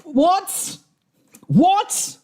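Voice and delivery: a stunned yelp of what; startled high what. Real, not acted. Reacting to the clip, a high shocked what. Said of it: "God, my accent went finish".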